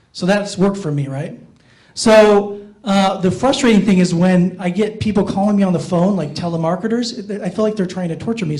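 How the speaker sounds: ordinary talk, nothing marked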